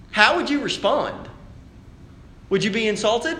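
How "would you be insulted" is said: normal talking voice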